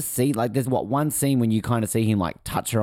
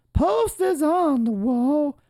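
very high, slow, drawn-out POSTERS ON THE WALL; playful slow squeal on a held high pitch; high-pitched echo; much slower than usual. Pretend. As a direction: stretch each word out in a silly high voice. High drawn-out echo of own phrase.

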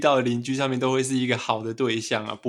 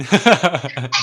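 short, loud burst of laughter; 6 dB louder than usual. Real, not acted. Co-host laughing at a joke about a flattering note, amused laugh.

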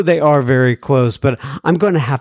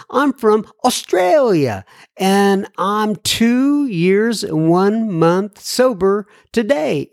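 slow, higher-pitched attempt at an Australian accent; 4 semitones higher, slower than usual. Podcast host reading a listener's email from Australia, attempted Australian accent. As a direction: put on an amateur Australian accent, drawn out. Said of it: "my accents are not up to par".

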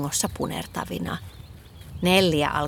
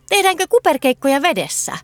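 high, fast eager question; excited asking; 8 semitones higher, 4 dB louder, faster than usual. A narrator's reading. Girl inviting friends to somersault, excited. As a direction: bubble over with the idea. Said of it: "Nelli kysyi innostuneena".

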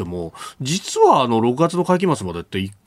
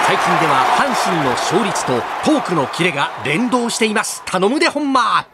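sports commentator; 8 semitones higher than usual. Acted. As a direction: hype play-by-play voice.

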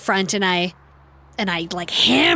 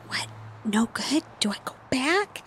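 whisper yelling; 3 semitones higher, 8 dB quieter than usual. Retold or imitated. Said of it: "like, whisper yell at him".

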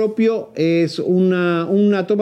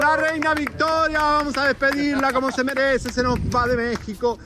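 calling out a send-off; 5 semitones higher than usual. Real, not acted. Reporter to fans at Victoria's departure, calling out a farewell. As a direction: whip up the crowd for a star's send-off.

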